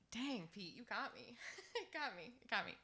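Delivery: holding back tears mid-sentence, laughing through it; tearing up. Real, not acted. Singer on stage, moved by a friend's words, choked up and near tears. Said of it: "And now I'm gonna cry".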